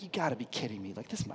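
low, hushed mock fury; retold anger. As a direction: hold the retold anger in, very quietly. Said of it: "I was so angry".

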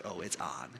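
very low, quiet acceptance of a dare; hushed challenge. Retold or imitated. Dad accepting his daughter's race to the top, hushed challenge. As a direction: drop it low under your breath.

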